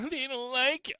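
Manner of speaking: high, gleeful laughing repeat; laughing mockery. Real, not acted. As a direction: chuckle it back, savouring it. Repeat mocking the left.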